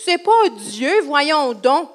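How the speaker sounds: high-pitched, drawn-out mock outrage; indignant Pharisees. Storyteller voicing Pharisees angered by Jesus' claim, indignant. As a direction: protest in exaggerated, indignant disbelief. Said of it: "ça mettait les pharisiens en colère".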